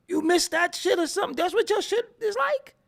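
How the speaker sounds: ordinary delivery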